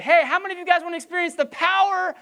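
high, loud hype-man voice; hyped-up question. Pretend. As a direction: pump the crowd up, mock rally. Preacher mimics excited hype question.

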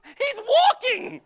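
high, loud, drawn-out exclamation; incredulous shout. Acted. Preacher mocking objectors to a miracle, incredulous shout. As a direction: cry out at the absurdity, stretching the words.

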